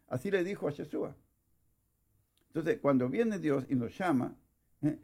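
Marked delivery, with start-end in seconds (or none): none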